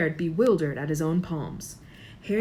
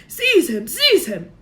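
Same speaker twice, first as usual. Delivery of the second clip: high loud shriek; Voldemort shrieking at Quirrell; 8 semitones higher, 11 dB louder, slower than usual. A narrator's reading. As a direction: shriek the order twice. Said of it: "shrieked Voldemort again".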